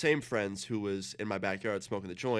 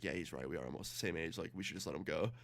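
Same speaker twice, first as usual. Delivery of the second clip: low, soft imitation of the boyfriend; subdued agreement; 5 semitones lower, 9 dB quieter than usual. Boyfriend tells babysitter to let him go, subdued. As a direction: concede it quietly, well down in pitch.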